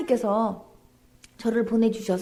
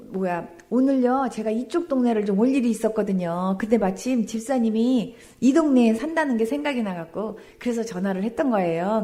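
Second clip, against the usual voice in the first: ordinary talk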